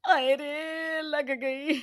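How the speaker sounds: slow, drawn-out groan of pain; hurt. Acted. Mother-in-law landing hard on the floor, slow pained groan. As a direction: stretch it out like a bruised fall. Falls from above and gets hurt.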